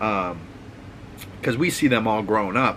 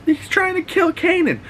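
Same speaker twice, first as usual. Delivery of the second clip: much higher, frantic mock panic; panicked cry. Hannah running to Rock for help, high mock panic. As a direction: shriek the warning like a scared girl.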